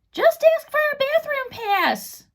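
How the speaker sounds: a know-it-all offering the easy answer; girl's voice, Nikki. A narrator's reading. Nikki whispering advice to the boys, high girl voice.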